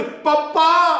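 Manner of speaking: high, loud shocked "Papa!"; astonished cry. Acted. Daughter recognizing her father, crying out in shock. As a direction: cry out in total surprise. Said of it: "આશ્ચર્યથી આંખ પોળી થઈ ગઈ".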